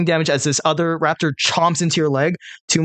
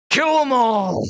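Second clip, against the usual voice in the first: battle command shout; 9 semitones higher, slower than usual. Acted. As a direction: bark the kill order at the crew. Hobgoblin commands the attack.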